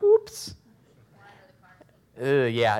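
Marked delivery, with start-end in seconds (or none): 0.0-0.5 mock oops. Parent finding an old cup.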